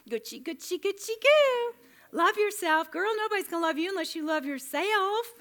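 put-on high, loud sing-song girl talk; mock cheerleader voice. Mocking the social media voice, high girly gushing.

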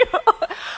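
short unvoiced laugh; breathy laugh. Real, not acted. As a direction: laugh at your own mock prayer. Laughs at own impression.

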